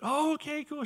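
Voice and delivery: high-pitched mock-excited reply; bubbly imitation, voice jumping high. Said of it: "She's all excited".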